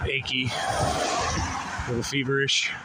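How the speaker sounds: stuffy nose, huffing a bit; blocked nose. Real, not acted. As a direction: talk through a blocked nose. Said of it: "it sounds like I'm all huffing and puffing 'cause I can't breathe through my nose".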